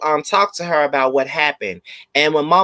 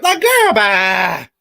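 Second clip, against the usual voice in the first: dismissive; 5 semitones higher, 5 dB louder, much slower than usual. Real, not acted. Dismissing the mother.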